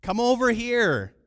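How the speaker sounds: loud, insistent summons; calling out. Acted. Diner calls a waiter over, loud calling out. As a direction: summon someone from across the room. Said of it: "call out".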